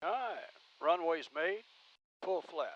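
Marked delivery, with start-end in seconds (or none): none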